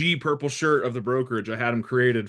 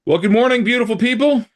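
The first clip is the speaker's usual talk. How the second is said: cheery greeting; 10 semitones higher, 6 dB louder than usual. Acted. Cheery show intro greeting.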